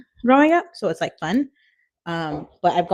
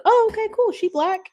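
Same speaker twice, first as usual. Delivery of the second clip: mock classmates. Imitating classmates' reaction.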